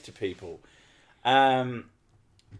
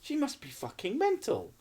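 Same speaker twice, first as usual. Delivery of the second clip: high, quieter disbelieving aside; incredulous; 9 semitones higher, 5 dB quieter than usual. Retold or imitated. Retelling their thoughts about the neighbour, incredulous. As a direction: marvel at how crazy she is. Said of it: "We thought".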